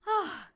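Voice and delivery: high sighing ah; demo sigh. Shown as a demonstration. Coach showing the sigh with an energised body, sighing. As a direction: sigh it out on one breath. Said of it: "even though you're going".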